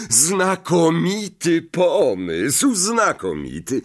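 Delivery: high, drawn-out delighted approval. A narrator's reading. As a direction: gush over a splendid idea. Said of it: "ucieszył się Lockhart".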